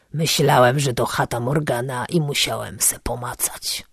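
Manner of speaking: low, soft, grubby voice of a park tramp; vagrant. A narrator's reading. Toothless vagrant explaining himself, low and soft. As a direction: mumble like a toothless drifter.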